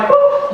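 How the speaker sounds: very high chirped beep like a navigator reroute; high GPS beep. Retold or imitated. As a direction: make a little high electronic ping. GPS sound effect.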